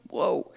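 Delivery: soft unvoiced laugh and whoa; a quiet amused gasp; breathy laugh whoa. Real, not acted. Preacher reacts to Jonah's reply with a soft laugh.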